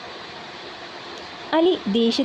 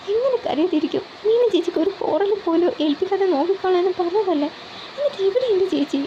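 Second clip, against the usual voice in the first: high, accusing, voice close to crying; angry tearful reproach; 8 semitones higher than usual. Acted. Younger sister confronts Alex over her missing sister, high tearful anger. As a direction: blame him for losing her sister. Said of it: "അവൾ ദേഷ്യത്തോട് ചോദിച്ചു".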